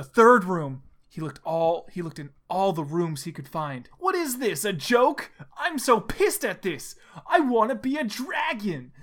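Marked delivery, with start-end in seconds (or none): 4.0-8.9 higher-pitched, exasperated whining at oneself; annoyed outburst. A narrator's reading. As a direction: complain out loud, pitched up. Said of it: "said Sonic out loud to himself".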